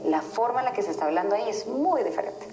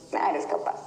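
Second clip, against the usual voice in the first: whispered inner voice; 3 semitones lower than usual. Shown as a demonstration. Coach contrasts the same phrase, now whispered to herself. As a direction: breathe no eres capaz like a hidden doubt. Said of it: "identifica en qué tono te habla esa voz".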